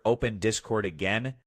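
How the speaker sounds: usual speaking voice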